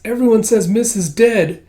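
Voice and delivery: high, loud, upset character voice; upset young protester. Acted. Kippa protests that her friend is dead, upset. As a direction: pitch it up and protest loudly.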